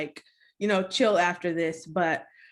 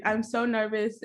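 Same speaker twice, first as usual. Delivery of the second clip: normal talking voice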